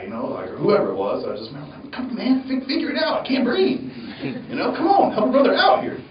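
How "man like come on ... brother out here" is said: pitched way up, laughing through a frustrated plea; exasperated mock plea. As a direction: beg them, half laughing, to fix the mask. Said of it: "I remember getting so mad at that guy".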